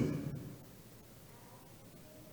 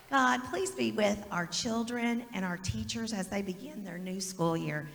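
prayer; 4 dB quieter than usual. Acted. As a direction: pray quietly over the children. Leads a prayer with the kids.

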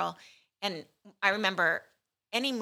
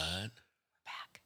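whispered sign-on, no voice; 14 dB quieter than usual. Pretend. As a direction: breathe it out in a hushed whisper. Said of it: "wait, shh".

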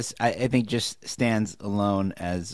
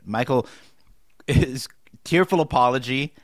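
ordinary talk

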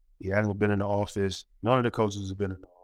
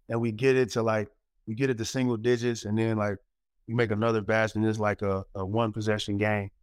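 ordinary talk, nothing marked

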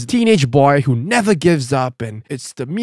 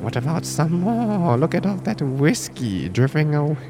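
devil dog; 3 semitones lower, 6 dB quieter than usual. Acted. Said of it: "The devil dog says".

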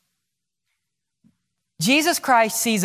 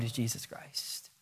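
hushed reverence; 10 semitones lower, 17 dB quieter than usual. Acted. Dramatic reveal of the name.

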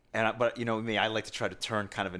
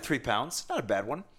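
normal talking voice

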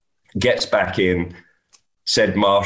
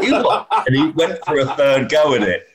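high-pitched, breaking into laughter; laughing; 7 semitones higher than usual. Real, not acted. Caddy recalling his player's third repeat, laughing. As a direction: tell the punchline through giggles. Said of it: "I was giggling so much".

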